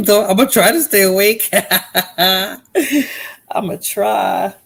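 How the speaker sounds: laughing as you try to stay awake. Real, not acted. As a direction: giggle your way through the promise to stay up. Laughing while promising to stay awake.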